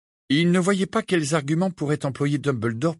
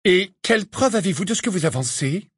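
higher, sharp interrupting teacher; McGonagall's voice; 8 semitones higher than usual. Acted. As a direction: cut in crisply as a stern teacher. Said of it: "interrompit le professeur McGonagall".

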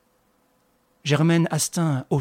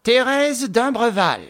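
higher, louder, slow accusing name; furious accusation; burst of anger; 10 semitones higher, 5 dB louder, slower than usual. A narrator's reading. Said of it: "dans un éclat de colère".

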